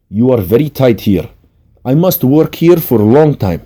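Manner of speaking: put-on firm assessment in a Russian accent. A narrator's reading. Masseuse finding a tight scapula on client, Russian accent. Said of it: "said in her Russian accent".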